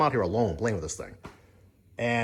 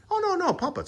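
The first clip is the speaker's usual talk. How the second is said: much higher, eager know-it-all tone; mock pushy salesman. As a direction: squeak it out like an overconfident salesman. Salesman insisting, retold.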